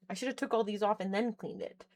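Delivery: ordinary talk, nothing marked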